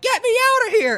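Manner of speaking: high, loud imitation of a trapped victim; mock yell. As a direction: yell it shrilly high, imitating a desperate captive. Voicing trapped woman yelling in film.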